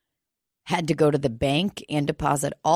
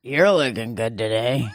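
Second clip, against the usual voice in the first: ventriloquist style, lips still; talking with mouth closed; 6 semitones lower than usual. Shown as a demonstration. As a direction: keep the mouth shut while speaking. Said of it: "you can say it without moving your mouth".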